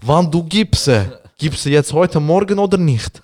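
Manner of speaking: rough builder heckling a coworker; construction-site bloke. Retold or imitated. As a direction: bark it like a site foreman. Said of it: "Modus von Baustelle".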